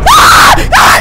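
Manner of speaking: very high, loud shriek; terrified scream. Real, not acted. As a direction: scream as the monster catches you. Caught by Slenderman.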